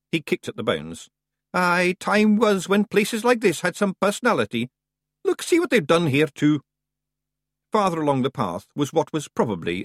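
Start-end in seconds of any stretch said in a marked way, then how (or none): 1.5-6.6 high, loud broad Scottish pixie voice; a cocky wee Scots fighter; tiny Scots warrior. Acted. Rob Anybody answering the boy, high broad Scots.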